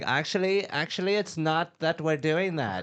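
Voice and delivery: high, whiny mock spokesperson. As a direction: sneer it like an annoying PR rep. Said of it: "We're like".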